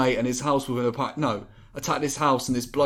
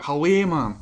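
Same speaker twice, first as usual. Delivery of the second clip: stock Geordie catchphrase, a bit higher; Geordie accent; 4 semitones higher than usual. Shown as a demonstration. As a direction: wind into Geordie with the greeting. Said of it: "usually with Geordie you'd be like".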